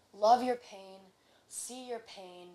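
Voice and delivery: ordinary delivery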